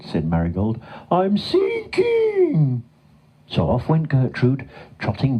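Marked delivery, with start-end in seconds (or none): 1.1-2.8 very high, long-drawn wail; panicked cow wail. A narrator's reading. Stuck cow to her friend as she sinks, wailing. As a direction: stretch it slowly into a high cartoon cry.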